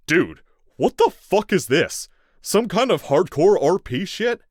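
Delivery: loud, high, outraged yell; outraged shout. A narrator's reading. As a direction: yell it in disbelief. Captive man outraged and loud.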